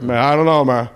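lightly voiced lazy dog character. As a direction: drop into a goofy dog voice, lightly.

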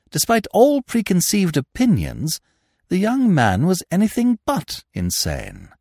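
ordinary delivery

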